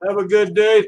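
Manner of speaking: normal talking voice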